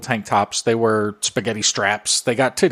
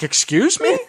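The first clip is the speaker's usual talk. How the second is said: very high indignant question; offended surprise; 11 semitones higher than usual. Retold or imitated. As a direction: act shocked and offended. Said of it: "she was like".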